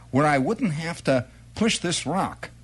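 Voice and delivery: ordinary delivery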